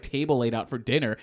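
ordinary talk

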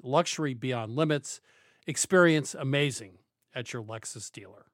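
smooth, measured commercial read. Acted. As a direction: read it like a radio sponsor spot. Host reads a car ad.

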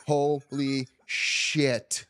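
very slow, stretched-out exclamation; retold stunned reaction, long vowels; drawn-out shock. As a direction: draw each word right out in disbelief. Acted shocked reaction to labour.

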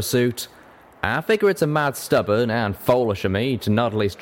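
rustic country storyteller; drawling cowpony. Acted.

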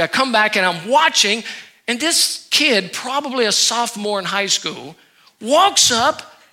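ordinary delivery

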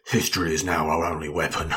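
low, overdramatic movie-hero line. Acted. Co-host quoting the monologue's closing line, mock-dramatic Bale impression. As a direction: intone it like a grave voiceover. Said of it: "I love your Bale impression".

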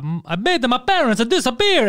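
lightly done fake Italian accent; a hint of a hammy Italian. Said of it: "but with fake Italian accents".

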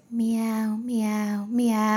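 catlike meows, ending in laughter; lightly imitated cat meows; mock cat call. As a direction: meow at someone like a leering driver. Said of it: "looks me straight in the eye and goes".

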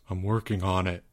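low, soft, sheepish mutter; mumble. A narrator's reading. Albert reminded of his dues, low soft mumble. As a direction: mumble it low under your breath. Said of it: "mumbled Albert".

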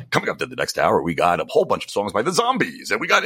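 hammed-up radio announcer patter; morning zoo radio guy. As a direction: sell the next hour like a cheesy radio host. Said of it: "Stern always talks about the radio guy".

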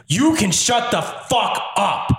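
cold command. Acted. Villain silencing a mouthy player, slow and contemptuous. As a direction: bite off each word at the heckler. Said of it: "he says".

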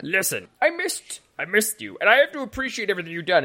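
high voice speeding into gushing thanks; hammed-up, high-pitched gratitude; manic, grateful stable man. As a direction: plead affection as the overwrought stable man. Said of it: "He goes".

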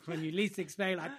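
ordinary talk

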